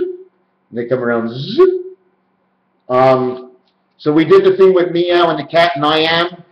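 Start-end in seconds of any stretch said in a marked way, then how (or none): 1.3-1.7 swooping "zzzip" rising sharply in pitch; zip noise. Shown as a demonstration. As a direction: imitate something whizzing round. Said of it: "coming around like zip".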